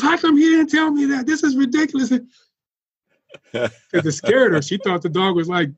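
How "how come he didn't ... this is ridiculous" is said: put-on much higher, indignant old woman voice; flustered grandma. Grandma complaining the dog ignores her, high indignant voice. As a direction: squeak it out like an upset grandmother. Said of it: "She goes".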